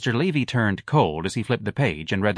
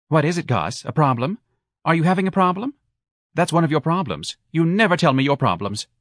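higher-pitched needling questions; prying wife; 7 semitones higher, 3 dB louder than usual. A narrator's reading. Wife nags Gus about his problem, prying. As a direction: pester him in a raised, lilting voice.

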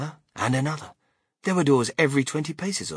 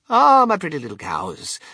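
loud, raised, fond exclamation; adoring cry; 5 semitones higher, 9 dB louder than usual. A narrator's reading. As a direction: coo out loud over beloved pets. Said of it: "cried Mister Wonka".

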